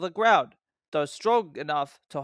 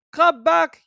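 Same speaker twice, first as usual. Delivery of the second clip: high, loud yell; yelled command; 12 semitones higher, 10 dB louder than usual. A narrator's reading. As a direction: call him back urgently. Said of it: "yelled the driver".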